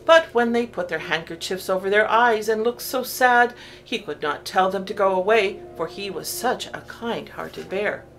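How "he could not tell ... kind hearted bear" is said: ordinary delivery, nothing marked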